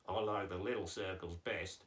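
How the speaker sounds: low, level Aussie puppet; lightly voiced Australian character; Australian accent. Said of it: "you're going with the Australian accent".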